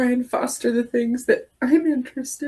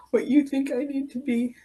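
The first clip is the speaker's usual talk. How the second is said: ordinary talk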